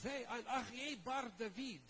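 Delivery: slow, high, grandly announced name with a laugh; proud introduction. Retold or imitated. Guide introducing himself to Joe, proudly. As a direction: announce your name with pride. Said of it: "He says".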